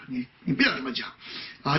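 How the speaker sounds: ordinary talk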